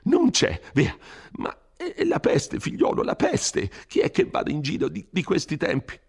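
put-on high, anxious old curate scolding; an old man begging him to flee, high and fearful; fretful old priest. Frightened curate urging Renzo to leave the village, pleading high and anxious.

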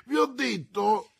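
high, urgent backtracking; desperate. A narrator's reading. Prisoner hurriedly correcting himself to the commissioner, desperate. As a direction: plead desperately at high pitch. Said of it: "esclamò disperato Bonacieux".

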